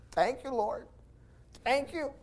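high, soft, awed thanks; grateful prayer. Retold or imitated. Preacher voicing his own awed thanks to the Lord, high and soft. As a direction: breathe out thanks in quiet wonder.

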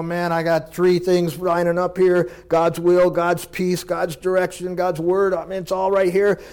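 ticking off the blessings with growing excitement; excited. Retold or imitated. Believer sees God's signs line up.

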